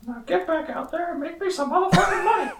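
high, squeaky cartoon shout; Mickey Mouse voice. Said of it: "do a whole episode in that voice".

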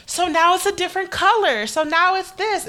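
high, prodding mock voice of a nosy coworker. Mocking coworkers about her hair.

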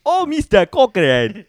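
high, slow, loud mock Indian accent; hammed-up Indian accent fan. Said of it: "you went straight Indian".

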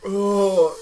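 slow words through burning lips; mouth burning from spicy food. Real, not acted. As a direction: struggle to talk past the heat. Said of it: "My lips are on fire".

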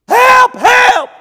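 high, loud panicked yelling; frantic cry for help. Retold or imitated. Ladies flailing in the river, shrill loud cries for help. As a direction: scream for help like you're drowning.

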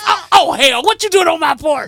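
loud, high, startled yell; mom still yelling. Retold or imitated. The mom recognizing her daughter yet still yelling, high and loud. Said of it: "She goes".

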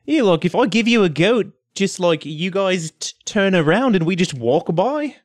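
easygoing bargaining voice; lizardfolk Reese. Acted. Reese bargains for safe passage, easygoing character accent. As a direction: haggle casually in character.

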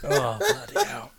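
high, breathy with laughter; laughing. Real, not acted. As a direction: sigh it out still laughing. Recovering from laughing fit.

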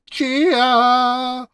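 loud, high, goofy shout of the name. Shown as a demonstration. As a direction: belt the name out on request, hamming it up. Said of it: "If you say it louder, we can hear it better".

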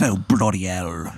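low, soft mock English grumble; unconvincing English accent. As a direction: grumble in bad fake English. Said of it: "his accent isn't convincing at all".

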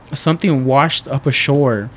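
usual speaking voice

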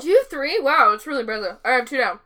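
mouth full. Real, not acted.

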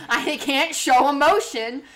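loud, high silly creature voice; mock Wookiee voice. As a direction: ham up a Wookiee who can't emote. Mock Wookiee speaking, laughing.

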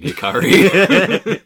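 high, drawn-out sarcastic name. Real, not acted. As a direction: drag the name out in mock annoyance. Sarcastic complaint at Hikari.

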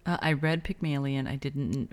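ordinary delivery

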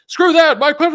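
high, loud mocking shout of an angry crowd; mock angry yell. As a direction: belt it out like a fed-up voter, mocking them. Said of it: "most people are like".

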